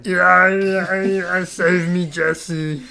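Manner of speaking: high, loud, slow anime character plea. Retold or imitated. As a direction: cry out like a cartoon hero begging. Anime voice impression, shouting.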